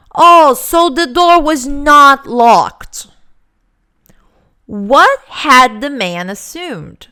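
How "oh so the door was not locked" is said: "Oh, so the door was not locked" with a big loud falling "Oh" and slow, weighted "not"; slow and loud, leaning hard on "not"; emphatic realisation. Shown as a demonstration. Teacher reading the man's surprised realisation, slow and loud. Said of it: "E ele fala assim".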